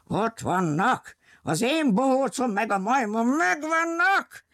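high yell rising through the line; blaring yell. A narrator's reading. Rombilli spots his runaways from the jeep, rising yell. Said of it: "Hirtelen üvölt és harsant".

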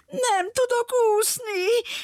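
put-on high, slow little-girl whimper. Girl whimpers to the lion that she can't swim. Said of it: "pityergett a kislány".

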